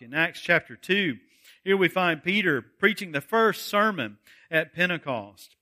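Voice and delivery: ordinary delivery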